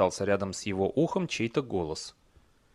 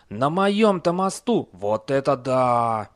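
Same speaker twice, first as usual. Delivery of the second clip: awestruck troll; 3 semitones higher, 5 dB louder, slower than usual. A narrator's reading. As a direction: marvel and draw out each phrase. Troll recognises famous barbarian. (восторженное изумление тролля)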